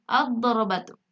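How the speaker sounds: "ad-darabatu" with a heavy doubled D; silent lam. Shown as a demonstration. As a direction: read "ad-darabatu" with no L, lam merged into dad. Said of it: "alif lamnya tidak dibaca jelas".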